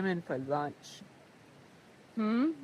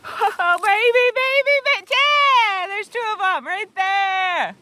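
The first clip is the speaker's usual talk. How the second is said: high, loud delighted squeals; thrilled squeals; 10 semitones higher, 9 dB louder, slower than usual. Real, not acted. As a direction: squeal with joy over the find. Finding morel mushrooms, thrilled.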